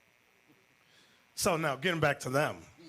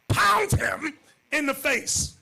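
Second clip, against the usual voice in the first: loud, higher, outraged; preacher shouting in disbelief; indignant shout; 11 semitones higher, 7 dB louder, slower than usual. Real, not acted.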